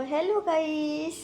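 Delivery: high, slow playful greeting for the pet; lightly voiced cat greeting the viewers; voicing the cat. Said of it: "বলে দাও".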